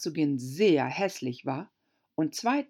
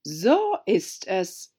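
slow, louder duchess agreement; Herzogin, gravitätisch; 3 semitones higher, 4 dB louder, much slower than usual. A narrator's reading. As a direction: savour it grandly. Herzogin stimmt zu. (Herzogin stimmt langsam und lauter zu)

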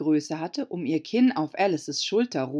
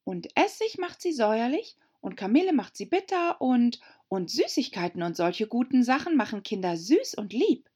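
put-on higher girl's voice, pleased with her new rule; Alice, delighted; 6 semitones higher than usual. A narrator's reading. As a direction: spin the theory gleefully. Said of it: "sehr erfreut". (Alice freut sich über ihre neue Regel, höher)

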